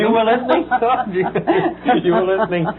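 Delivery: high, laughing and incredulous; laughing disbelief. Real, not acted. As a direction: laugh it out in surprise. Host caught off guard, laughing.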